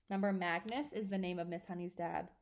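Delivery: ordinary delivery, nothing marked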